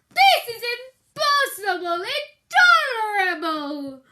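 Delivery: very loud wailing roar; weak roar. Acted. Bear trying to roar at Mouse, wailing. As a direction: roar it but too weak. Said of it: "Bear started to roar".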